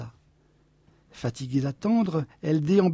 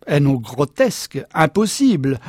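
loud, dismissive objection; emphatic scornful husband; objecting; 6 dB louder than usual. A narrator's reading. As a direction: dismiss it forcefully as nonsense. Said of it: "objecta le mari".

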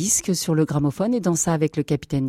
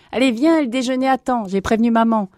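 high, louder cheerful summons; eager urging; 8 semitones higher, 4 dB louder than usual. Acted. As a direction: hurry a brother along to lunch. Brother urges him home.

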